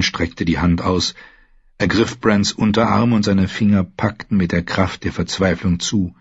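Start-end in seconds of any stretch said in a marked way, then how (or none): none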